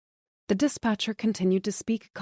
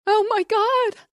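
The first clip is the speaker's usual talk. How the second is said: very high, loud, slow scream; wife screaming to the dispatcher; 7 dB louder, slower than usual. A narrator's reading. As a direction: scream in horror. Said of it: "she screamed".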